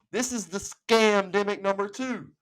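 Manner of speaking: usual speaking voice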